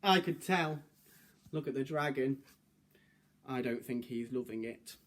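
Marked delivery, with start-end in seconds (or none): none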